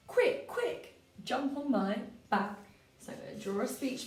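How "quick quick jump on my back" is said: high, quick little bunny voice; urgent bunny calling to crumpet man. Acted. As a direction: squeak it out like an eager rabbit. Teacher voices the bunny character.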